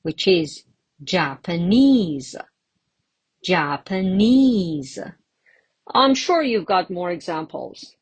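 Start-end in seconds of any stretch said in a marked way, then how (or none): 1.0-5.0 Japanese with NESE stretched and punched; JapaNESE, exaggerated final stress; stress on third syllable. Shown as a demonstration. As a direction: lean hard on the end of Japanese. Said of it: "On the third syllable".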